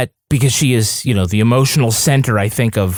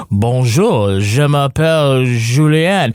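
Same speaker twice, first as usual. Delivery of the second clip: put-on slow, proper Parisian French accent; a listener hears a Parisian French accent, lightly put on; much slower than usual. Colleagues mocking the Paris myth, in a lightly put-on Parisian French accent. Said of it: "talk like they do in Paris".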